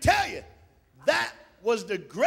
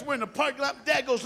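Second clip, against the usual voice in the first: normal talking voice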